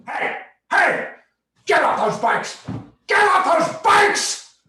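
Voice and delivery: furious high-pitched yell; explosive shout, stretched out at the end; park ranger screaming. Retold or imitated. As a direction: scream the order like an enraged ranger. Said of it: "screaming at us to get off the bikes".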